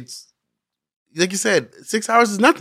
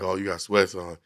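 low, softer mock of a dismissive bouncer; 8 semitones lower, 8 dB quieter than usual. Retelling bouncers turning his cousin away, low mock voice. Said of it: "And they were like".

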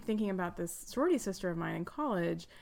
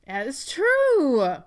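emphatic reveal; 5 semitones higher, 9 dB louder, much slower than usual. Acted. Game host reveals answer.

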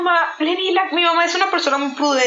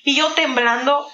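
ordinary delivery

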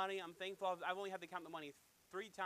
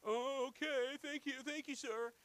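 high, pitiful beggar voice; hammed-up forlorn gratitude; mock whining thanks; 8 semitones higher, 3 dB louder, slower than usual. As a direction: whimper your thanks in an exaggerated whine. Same whining beggar, refused.

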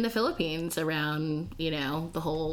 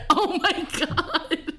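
slow, high, laughing "Oh my God"; embarrassed giggling disbelief; nervous laugh; 9 semitones higher, 3 dB louder, much slower than usual. Retold or imitated. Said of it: "laughed so nervously".

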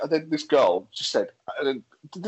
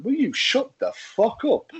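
higher, slow, deliberate angry snap; angry retort; 9 semitones higher, slower than usual. Retold or imitated. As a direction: spit each word out slowly at her. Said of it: "a fucking angry brummy saying".

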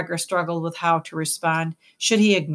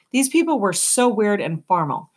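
usual speaking voice

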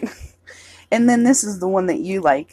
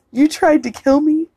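quoting Peter; 9 semitones higher, 5 dB louder than usual. Retold or imitated. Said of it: "And then he goes".

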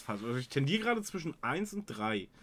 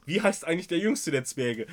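usual speaking voice

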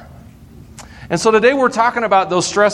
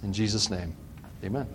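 soft, low prayer voice; 6 semitones lower, 5 dB quieter than usual. Real, not acted. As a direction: pray quietly over the room. Pastor prays over the congregation.

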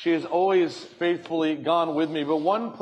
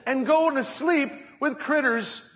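exasperated wife; 7 semitones higher than usual. Retold or imitated. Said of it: "And she's like".

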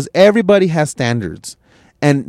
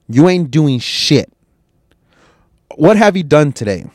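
normal talking voice

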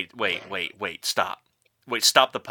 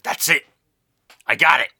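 loud, higher burst of inspiration; eureka moment; 5 semitones higher, 7 dB louder than usual. Acted. Writer hit by an idea on his deck, excited. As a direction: exclaim it like a sudden breakthrough.

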